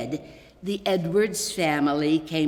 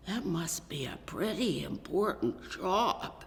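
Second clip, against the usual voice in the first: a quiet inner voice, barely spoken; whispered thought; 6 dB quieter than usual. Acted. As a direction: whisper it to yourself, impressed.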